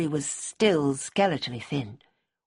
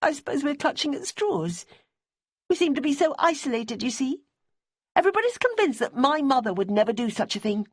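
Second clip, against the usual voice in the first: higher, pleading woman's voice; Debbie's voice; 10 semitones higher than usual. A narrator's reading. Debbie asking Simmy about Bonnie's boyfriend, high and earnest. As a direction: voice a worried, earnest widow.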